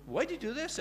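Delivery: puzzled headmaster. Retold or imitated. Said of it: "and he said".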